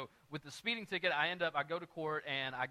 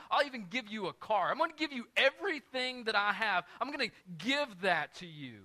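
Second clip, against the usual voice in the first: higher and louder, piling up offers; building excitement; 6 semitones higher, 6 dB louder than usual. Retold or imitated. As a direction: get more eager with each offer. Judge invites pastor home, escalating.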